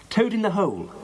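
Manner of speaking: high-pitched called-out answer; shouting back. A narrator's reading. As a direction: call the password back, high and emphatic. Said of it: "shouted back Janet".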